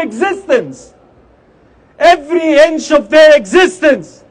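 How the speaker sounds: fiery protest speech; rally the crowd with force; loud soapbox ranting. Acted.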